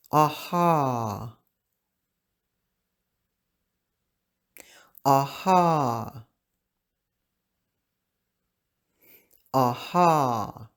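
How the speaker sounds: aha with two open ah sounds, paced for learners; repeat-after-me aha. Shown as a demonstration. Teacher leading students to repeat aha, clear and open. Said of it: "We're gonna say this three times".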